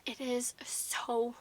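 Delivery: slow, hushed whisper. Real, not acted. Admiring palette.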